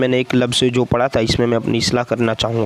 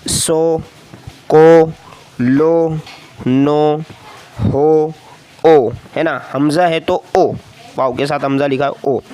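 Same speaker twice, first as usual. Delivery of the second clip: each syllable set apart; teacher drilling syllables for beginners; slower than usual. Shown as a demonstration. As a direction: read out like a reading teacher. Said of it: "इस तरह आगे देखिए".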